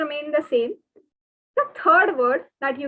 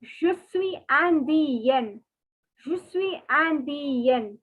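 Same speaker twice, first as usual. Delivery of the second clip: Je suis indienne slowly, ending yen; slow French, feminine; much slower than usual. Shown as a demonstration. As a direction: model the girls' form slowly. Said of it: "For girls, it is".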